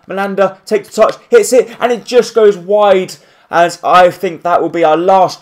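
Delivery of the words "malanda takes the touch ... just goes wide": rising pitch into a near miss; excited commentary. Real, not acted. Gamer calling his last chance, pitch climbing higher. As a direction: voice climbs as the shot flies wide. Late chance in final minutes.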